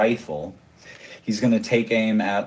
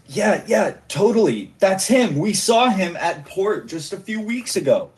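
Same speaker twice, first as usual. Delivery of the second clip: higher, eager gushing agreement; eager henchman; 9 semitones higher than usual. Acted. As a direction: vouch for him excitedly. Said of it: "then this guy says".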